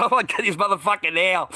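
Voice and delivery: high-pitched mock yell; retold yell. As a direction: repeat an angry threat for the story. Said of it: "I said, I yelled it".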